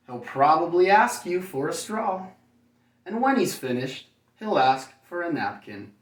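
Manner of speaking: put-on reading to children. A narrator's reading. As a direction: read it like bedtime story to little kids. Reads picture book to children.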